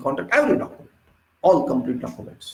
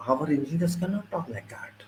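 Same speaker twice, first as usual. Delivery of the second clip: soft whispered aside, much softer; whispered admission; 8 dB quieter than usual. Real, not acted. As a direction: drop to a whisper as if confessing a shame. Confessing a shortcoming.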